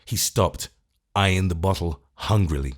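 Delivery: usual speaking voice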